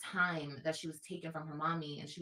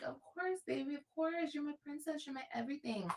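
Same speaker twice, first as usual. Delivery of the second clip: tender mom reassurance; 9 semitones higher than usual. Retold or imitated. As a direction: reassure your child she is loved. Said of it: "And I'm like".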